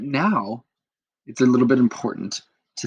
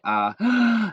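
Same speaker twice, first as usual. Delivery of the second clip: high gasp of a sly idea; scheming gasp; 10 semitones higher than usual. Acted. Magdalena delighted by her prank, sharp high gasp. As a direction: gasp as if struck by a wicked plan.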